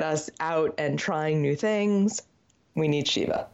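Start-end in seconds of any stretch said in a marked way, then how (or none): none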